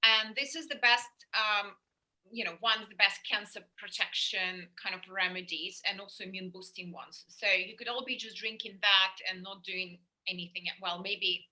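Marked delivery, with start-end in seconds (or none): none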